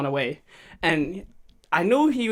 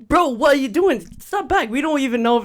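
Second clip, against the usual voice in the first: high, louder telling-off; alarmed scolding; 8 semitones higher, 4 dB louder than usual. Retold or imitated. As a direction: urgently call the kid back from the door. Retold scolding of brother at door.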